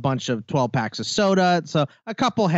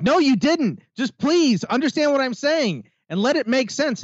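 pleading fans; 11 semitones higher than usual. Retold or imitated. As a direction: plead up high like frustrated fans. Fans voiced pleading, high and loud.

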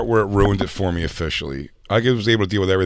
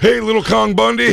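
lightly voiced, pitched-up wrestler; a touch of a high cartoon wrestler; 11 semitones higher than usual. Voicing wrestler naming dogs.

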